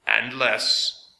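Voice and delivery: slow metallic machine voice; strange metallic voice. A narrator's reading. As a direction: speak like a grinding machine. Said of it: "in the same strange metallic voice".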